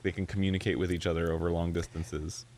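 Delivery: ordinary delivery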